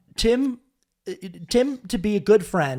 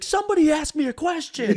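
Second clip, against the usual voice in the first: high-pitched mock plea; begging; 10 semitones higher than usual. Gunman begging party guests for a question, pleading. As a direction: beg in a high, strained impression. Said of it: "begging them to".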